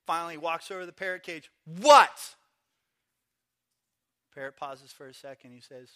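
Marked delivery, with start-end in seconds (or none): 1.7-2.4 very loud one-word retort; acted, fed-up holler; exasperated shout. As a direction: yell it back at a pest. Exasperated man answers parrot.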